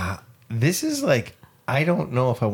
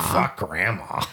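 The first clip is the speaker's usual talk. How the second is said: mock old man; 3 semitones lower, 3 dB quieter, slower than usual. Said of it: "the granddaughter says".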